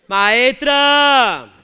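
grita para llamarla, alargando; llámala fuerte desde el público; llamada a gritos. Acted. Espontáneo llama a la cómica. (el espontáneo grita a la maestra desde la butaca)

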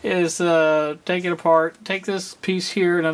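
ordinary delivery

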